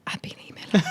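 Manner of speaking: whispered star-struck aside; a starstruck whisper to a colleague; awed whisper. Retold or imitated. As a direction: breathe the name in a hushed whisper. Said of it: "she also saw me go".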